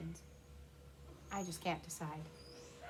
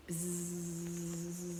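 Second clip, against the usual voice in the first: soft buzzing hum; bee buzz; 4 semitones lower, 5 dB quieter than usual. A narrator's reading. Bees in the flowers, soft buzz. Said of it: "I hear bees".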